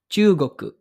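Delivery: Chugoku with both u sounds held long; long u vowels. Shown as a demonstration. Teacher pointing out the two long u's. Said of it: "yung dalawang U sa Chugoku".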